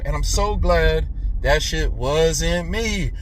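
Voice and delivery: normal talking voice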